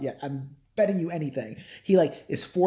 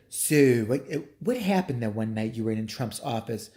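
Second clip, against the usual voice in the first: lightly lowered TV interviewer voice; lightly voiced interviewer; 7 semitones lower than usual. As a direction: put the question as a low-voiced interviewer. Voices the TV interviewer questioning her.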